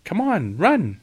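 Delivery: high, loud urging call; excited call. A narrator's reading. Girl urging her sister to run across the ice, excited call. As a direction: call out eagerly to get them running.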